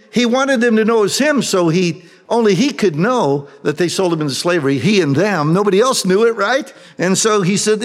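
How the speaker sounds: usual speaking voice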